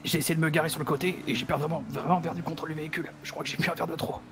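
usual speaking voice

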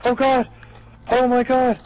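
startled, frightened cries; real fright from a jump scare; scared screaming. Real, not acted. Jump scare just hit.

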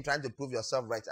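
ordinary talk

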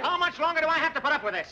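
fed-up outburst; exasperated. Acted. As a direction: protest at the end of patience. Exasperated by constant interruptions.

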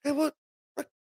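high-pitched, protesting comeback; mock retort. Comedian answers Italian American commenters, mock indignant retort.

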